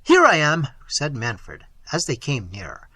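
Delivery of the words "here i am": calling back. Acted. Prince answers distant calling.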